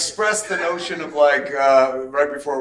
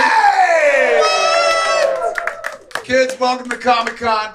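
excited shout; 11 semitones higher, 7 dB louder, slower than usual. Real, not acted. Greeting cheering crowd.

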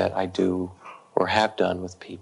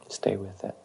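hushed; 8 dB quieter than usual. Retold or imitated. Teacher's hushed guidance.

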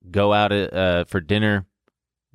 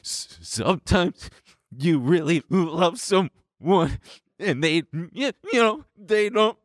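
put-on high, slow, gentle explaining to kids; simple and gentle; 8 semitones higher, slower than usual. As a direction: spell out heartbreak gently, like for small children. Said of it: "I tried to dumb heartbreak down for them".

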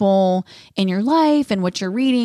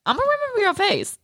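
much higher, quick, playful warning; imitating the church lady; 13 semitones higher, faster than usual. Quoting the lady inviting her to church, playfully higher. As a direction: mimic a sweet church lady teasing. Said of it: "she's like".

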